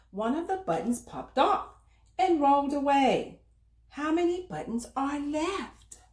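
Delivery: put-on bright, lively read-aloud for little kids; reading to children. A narrator's reading. Storyteller reading aloud to children, animated storytime voice.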